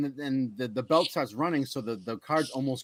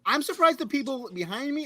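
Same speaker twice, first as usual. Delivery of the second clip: ordinary delivery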